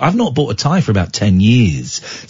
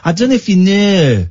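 higher-pitched mock Hull vowels; imitating Hull accent; 5 semitones higher than usual. Host teasing caller about Hull, imitated Hull accent. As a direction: mimic Hull O sounds. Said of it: "that's what I'm saying".

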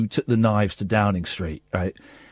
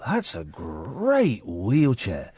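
drawn-out admiration; 4 semitones lower, 4 dB quieter, much slower than usual. Retold or imitated. Voicing Mister Rogers, drawn out.

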